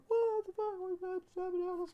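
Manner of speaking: very high squeal of a voice; squeaky Elizabeth. Acted. Comedian voicing Elizabeth answering Meliodas, very high squeaky put-on voice. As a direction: squeak it out far above your range. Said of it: "And she's like".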